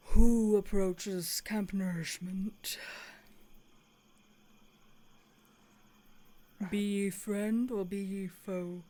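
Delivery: slow, hushed challenge from a hidden guard; a watchman calling from the trees, slow and grave; lightly voiced deep sentry. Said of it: "this very deep voice from off to the right".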